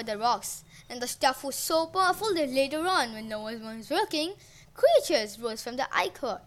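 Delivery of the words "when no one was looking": plain reading voice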